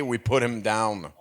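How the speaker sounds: sudden low deep voice; extremely deep bass voice. Real, not acted. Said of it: "la voix la plus grave de la soirée".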